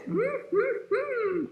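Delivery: very high, relishing mm-mm; hungry fox hum. Acted. Fox eyes the little chickens, high greedy humming. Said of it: "And the fox says".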